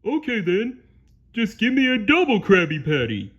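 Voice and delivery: high, loud, oblivious cartoon voice; Patrick. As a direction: order blithely in a big goofy voice. Patrick orders anyway.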